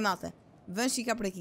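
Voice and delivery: ordinary talk, nothing marked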